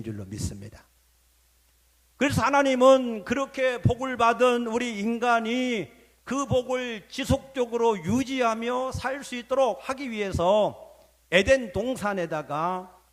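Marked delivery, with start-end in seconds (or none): 2.2-13.0 high, insistent sermon delivery; forceful preaching. Real, not acted. Preacher tells congregation of Eden's blessing, forceful preaching. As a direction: proclaim it in a raised preacher's chant.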